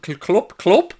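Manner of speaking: trying out a Dutch accent, pitch climbing; attempted Dutch accent. Shown as a demonstration. Streamer practising the manager's name in an attempted Dutch accent. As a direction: have a go at saying Klopp the Dutch way. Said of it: "They're gone for me Dutch accent".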